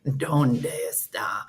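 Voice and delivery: slow, whispery creepy man; mimicked language-app man, drawn out; Duolingo man voice. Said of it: "He is this".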